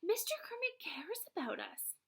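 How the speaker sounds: unvoiced awed whisper; breathed whisper. A narrator's reading. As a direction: breathe it out in wonder. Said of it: "Kiana breathes".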